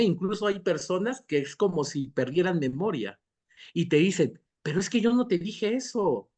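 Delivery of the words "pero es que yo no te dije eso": higher-pitched acted protest; protesting denial. Person who forgot denies having said it, protesting. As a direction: deny it, as a re-enacted quote. Acts out person denying their words.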